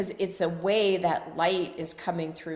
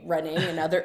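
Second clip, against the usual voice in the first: ordinary delivery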